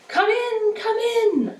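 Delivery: high sing-out call; high, drawn-out storybook call; faraway voice calling. A narrator's reading. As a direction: call "come in" as if from far off. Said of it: "a faraway voice called".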